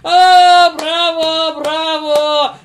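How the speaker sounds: frat bro cheers, loud and high; rowdy crowd shouting bravo. Acted. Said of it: "закричали".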